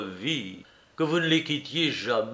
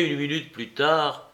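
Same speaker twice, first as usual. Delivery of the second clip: plain reading voice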